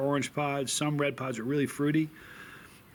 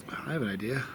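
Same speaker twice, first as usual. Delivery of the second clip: whispering; 3 semitones lower, 7 dB quieter, faster than usual. Real, not acted. Sudden whispered aside.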